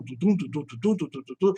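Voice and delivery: soft, low thumping heartbeat noises; heartbeat sounds. Retold or imitated. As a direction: tap out a heartbeat under the breath. Imita batidas do coração. (batidas de coração baixinhas e graves)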